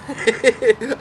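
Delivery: short real laugh; real chuckle. Real, not acted. Storyteller tickled by the prank line just told, laughing. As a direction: chuckle at your own punch line.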